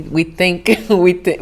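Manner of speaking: laughing while talking. Real, not acted. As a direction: chuckle through the words. Laughs mid-sentence, self-amused.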